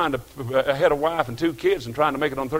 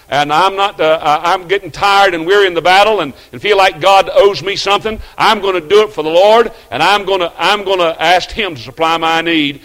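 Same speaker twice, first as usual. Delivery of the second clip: builds from steady to loud and higher; preacher stirring the congregation; rising preaching fervor; 5 semitones higher, 10 dB louder than usual. Acted. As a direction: swell into a full pulpit cry. Building sermon resolve.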